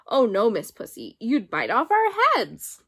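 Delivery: lightly voiced mice teasing; cheeky mice. A narrator's reading. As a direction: taunt the cat from safety. Mice answer the cat.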